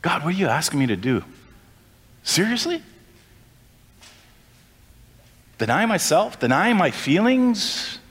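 complaining to God. Retold or imitated. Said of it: "what we say so many times".

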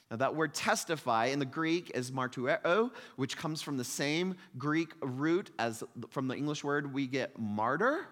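usual speaking voice

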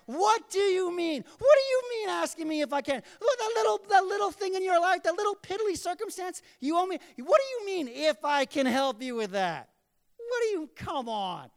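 high, loud, exasperated protest; hammed-up indignant disbelief at full volume; incredulous outburst. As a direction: act scandalized at being doubted, voice way up. Incredulous reply escalates, acted out.

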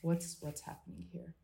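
sudden doubt. Retold or imitated. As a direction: catch yourself mid-stride, confused. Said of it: "it's like".

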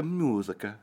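musica softly in an Italian accent. Shown as a demonstration. Host admiring the Italian language, Italian accent. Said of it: "love the Italian language".

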